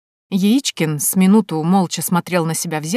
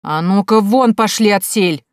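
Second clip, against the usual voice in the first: gruff shout, slow and loud; angry shout; 3 semitones higher, 5 dB louder than usual. Acted. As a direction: bark them out of the house. Officer drives off two men.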